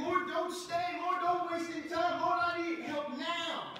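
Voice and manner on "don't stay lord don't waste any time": normal talking voice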